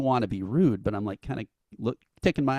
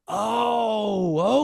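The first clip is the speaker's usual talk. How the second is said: surprised oh; 11 semitones higher, 5 dB louder than usual. Retold or imitated. Band realizes who they are.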